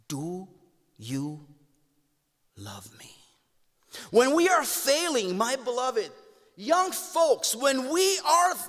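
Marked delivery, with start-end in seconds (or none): 0.1-3.1 tender question. Acted. Jesus asks Peter tenderly.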